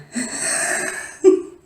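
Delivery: high breathy burst of laughter; laughing. Real, not acted. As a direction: laugh out loud at the memory. Laughs at the memory.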